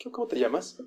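soft, unassuming question; pregunta tranquila. Acted. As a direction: ask quietly, as if you didn't know. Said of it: "Totalmente lo opuesto". (pregunta en voz baja, sin gritar)

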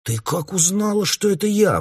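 high, shocked cry of alarm; horrified exclamation. A narrator's reading. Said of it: "воскликнул Гоша в ужасе". (испуганный возглас, высоко)

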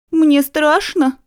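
high, slow frightened plea; small scared voice, barely protesting; timid protest. A narrator's reading. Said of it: "робкий протест".

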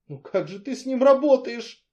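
bewildered. A narrator's reading. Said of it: "растерянно спросила Алевтина".

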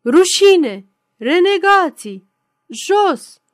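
loud, high, punched-out heckling; roared jeer. A narrator's reading. Lone heckler cutting off the cheers, roared jeer. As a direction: bellow each word as an accusation. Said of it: "un glas gros răcni".

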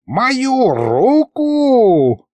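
put-on high, long-drawn old sorcerer's cry; outraged wail. A narrator's reading. Sorcerer fears losing his magic hand, wailing in outrage. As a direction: stretch it out in high-pitched outrage. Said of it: "завопил старый колдун".

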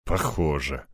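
low, quiet grimace; sour, muted agreement; wry distaste. A narrator's reading. Egor grimacing at the AI's imitation, wry. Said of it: "скривился я".

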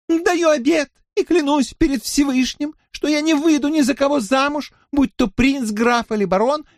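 very high, chirping cartoon princess; piercing little voice. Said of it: "прощебетала своим пронзительным голоском". (пронзительный щебечущий голосок)

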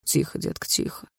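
quiet, breath-led hush; hushed, controlled soothing; soft hushing. Acted. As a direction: shush her softly. Boyfriend hushes girlfriend, finger on lips.

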